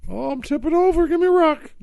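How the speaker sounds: high, loud cry for help; high-pitched mock alarm; comic panic. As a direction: yelp it out as if toppling over. Plays Rube needing pocket rocks.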